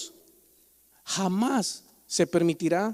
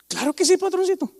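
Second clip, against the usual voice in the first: higher, fawning reply to the boss; mock servile worker; 9 semitones higher than usual. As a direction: play a humble obliging employee. (empleado servil y sumiso)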